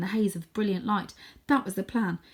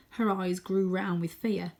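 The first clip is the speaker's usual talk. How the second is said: ordinary delivery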